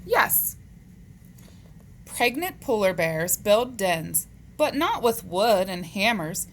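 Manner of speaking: animated, measured picture-book reading; read-aloud to children. Acted. Teacher reading animal facts to boys and girls, animated read-aloud. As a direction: read it out to young kids, lifting the questions.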